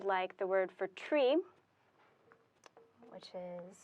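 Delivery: ordinary talk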